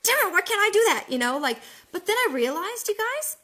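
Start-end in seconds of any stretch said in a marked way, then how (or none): 0.0-1.1 much higher and louder exasperated cry; frustrated outburst. Retold or imitated. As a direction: blurt out your frustration at yourself. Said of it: "And I'm just like".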